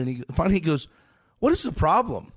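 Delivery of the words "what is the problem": retold irritated rocker, higher and louder; angry. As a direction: snap at the stubborn fan. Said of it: "he's angry now".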